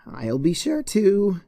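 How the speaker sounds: sweet on top, seething under; strained polite smile. Acted. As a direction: Twilight smiling tightly at Cheerilee. Forced smile.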